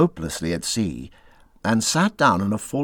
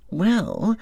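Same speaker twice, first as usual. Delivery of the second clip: slowly; 10 semitones higher than usual. A narrator's reading. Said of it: "he said at last slowly".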